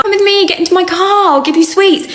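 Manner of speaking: high, sweet-talking luring voice; creepy stranger. Retold or imitated. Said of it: "somebody grooming a child".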